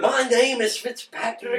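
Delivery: high, slow hammed-up Irish voice; mock Irish accent. Walking into an Irish bar, hammed-up high Irish accent. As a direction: stretch it out in a put-on Irish accent. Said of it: "You should have walked in there like".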